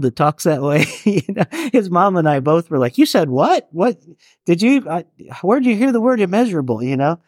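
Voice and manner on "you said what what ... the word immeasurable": higher, faster astonished questions; can't believe what you just heard; stunned disbelief. Retold or imitated. Parents questioning their son, amazed and disbelieving.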